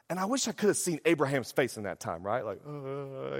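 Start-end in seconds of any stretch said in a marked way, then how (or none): 2.6-3.4 soft, drawn-out uneasy "uh"; hesitant groan. Retold or imitated. Preacher imitating Abraham hearing Sarah's plan, hesitant groan. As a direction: stall with a wary noise.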